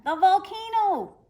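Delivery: boy shouting a fake volcano alarm; shouted. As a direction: bellow the warning across the sea. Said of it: "he shouted again".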